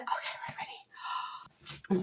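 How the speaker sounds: hushed whisper; soft anticipatory whisper. Real, not acted. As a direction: whisper it to build suspense. Whispering before unboxing.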